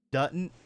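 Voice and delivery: "Doesn't" said as "dudn't"; southern slip. Shown as a demonstration. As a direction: turn the middle of "Doesn't" into a d, southern-style. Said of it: "the way I just pronounced doesn't".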